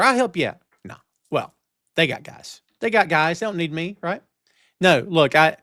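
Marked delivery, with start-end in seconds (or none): none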